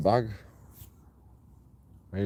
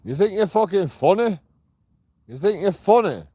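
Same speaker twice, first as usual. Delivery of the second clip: higher, louder angry retort; shouting back at hecklers in the dark; angry challenge; 7 semitones higher, 3 dB louder than usual. Real, not acted. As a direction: throw the question back at them. Confronting people who shout at him.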